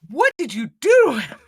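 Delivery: loud, high shocked demand; hammed-up shocked outcry; mock aghast. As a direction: cry out as the alarmed madame. Acted madame's alarmed reaction.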